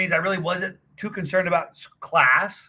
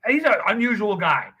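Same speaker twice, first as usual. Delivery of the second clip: ordinary delivery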